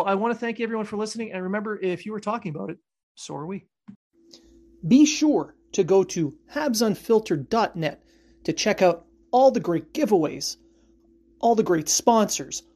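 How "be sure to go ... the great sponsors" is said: ad read. Acted. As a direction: sell it like a radio ad. Sponsor plug at show end.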